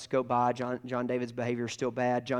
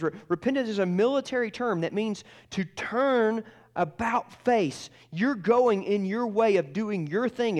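raised pitch, driving sermon delivery; impassioned preaching; 6 semitones higher, 3 dB louder than usual. Real, not acted. Preacher urging listeners to repent and run to Jesus, impassioned. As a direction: preach it urgently, building louder, then easing at the end.

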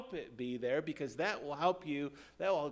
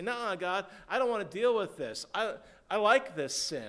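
louder, higher stubborn sinner; acted defiance, raised voice; defiant refusal; 4 semitones higher, 7 dB louder than usual. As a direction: refuse God outright, digging in. Acts a sinner refusing God.

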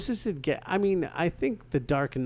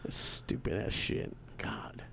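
slow disgusted whisper under breath; 5 semitones lower, 11 dB quieter, slower than usual. Real, not acted. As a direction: hiss it to yourself, fed up. Grumbling at bad movie.

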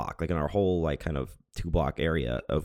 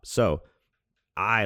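ordinary talk, nothing marked

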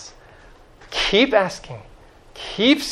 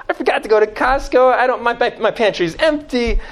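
high, hurried plea of a caught-out host; flustered friend; 8 semitones higher than usual. Acted. Friend begging bread at midnight after forgetting Costco, flustered. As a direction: play the unprepared friend, pitched up and quick. Acts out the friend without food.

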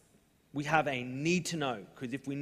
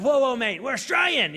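higher, louder whoa whoa mate; raised, alarmed Aussie interruption; loud protest; 9 semitones higher, 10 dB louder than usual. Retold or imitated. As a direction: call out to stop him mid-sentence. Stopping Italian-speaking stranger.

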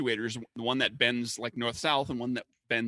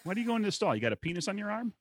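mock incredulous guy; 8 semitones higher, faster than usual. Imagined guy asking in restroom.

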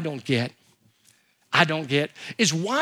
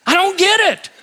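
high, loud exasperated exclamation; preacher ranting about fake meat, exasperated; 11 semitones higher, 6 dB louder than usual. Real, not acted. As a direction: throw up your hands in disbelief. Ranting about vegetarian restaurant.

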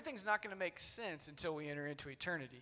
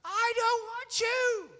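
put-on loud, high, stretched-out child's shriek; imitated tantrum screaming; 12 dB louder, much slower than usual. Dad acting his daughter's answer to his calm words, screaming. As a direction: scream back like an inconsolable little girl. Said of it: "She just kept screaming that".

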